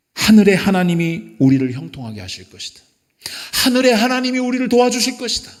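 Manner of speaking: loud, high declaration of faith; defiant pulpit cry; bold proclamation. Acted. Proclaims Nehemiah's answer to enemies.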